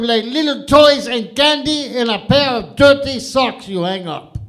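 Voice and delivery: loud, high, eager shouting; excited shouting Larry. Acted. Second Larry yelling his Santa answer at Saint Peter. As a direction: bellow it like a proud know-it-all.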